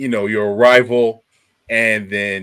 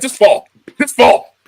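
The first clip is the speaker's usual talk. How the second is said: high, loud mocking singsong; mock taunting; 13 semitones higher, 8 dB louder than usual. Taunting someone falling on a bench, high and loud. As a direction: jeer at the falling guy in a high voice.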